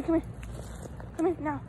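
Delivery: usual speaking voice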